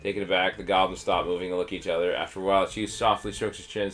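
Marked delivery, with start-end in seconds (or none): none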